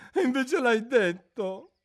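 high, mournful creature voice; a sorrowful old turtle reminiscing; mock turtle voice. Mock turtle telling Alice about school under the sea, mournfully.

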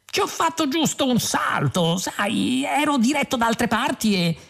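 high-pitched breezy chatter; chirpy, chatty self-excusing; garrulous. A narrator's reading. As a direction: babble an excuse high and airily. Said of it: "rispose Zaphod garrulo".

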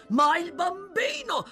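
high, loud cry of alarm; distressed outcry. Acted. Toby recalls the wounded boy during the chase, distressed outcry. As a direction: burst out with it mid-story.

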